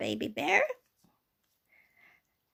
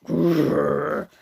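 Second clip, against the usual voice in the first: deep, loud bear growl; big low rolling growl; Daddy Bear growl; 8 semitones lower, 9 dB louder than usual. Acted. Daddy Bear growls at Goldilocks, deep and loud. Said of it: "Daddy Bear went".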